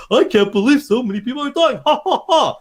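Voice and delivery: lightly voiced action hero with a hollow laugh; mock the campy hero shrugging off deaths; cheesy Leon line. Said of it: "it's not like the Leon going".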